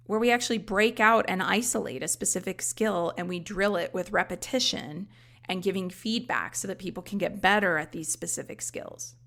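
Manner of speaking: ordinary talk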